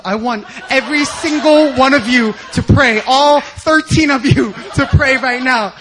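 a leader's shouted order, slowing at the end; commanding shout. Retold or imitated. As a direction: demand that all of them pray now. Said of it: "then she was like".

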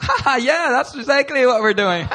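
high, forced laughing agreement; playing it off. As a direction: laugh it off with fake cheer. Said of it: "So we try to, like, play it off".

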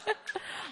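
soft, high burst of laughter; quiet laugh at your own joke; breathy giggle. Real, not acted. Laughs at own monkey idea.

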